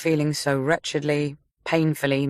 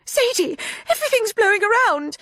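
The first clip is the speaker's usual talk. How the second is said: high, louder panicked cry; frightened cry; 4 dB louder than usual. A narrator's reading. Mia crying out to Sadie in panic. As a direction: call out in fear over the wind. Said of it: "Mia cried".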